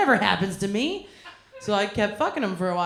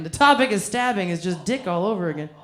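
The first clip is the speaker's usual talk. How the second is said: ordinary delivery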